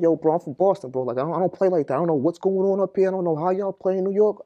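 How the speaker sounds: warning New York inmates, high and loud; angry warning. Retold or imitated. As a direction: stake your ground, I'm from Boston. Retold threat to New York inmates.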